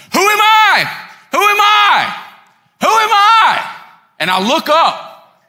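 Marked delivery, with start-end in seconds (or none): 0.1-3.8 very high, loud repeated shout; imitated yell across a crowded terminal; yelling over noise. Tour guide yelling to security over terminal noise, urgent shout. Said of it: "yelling over the noise of the terminal".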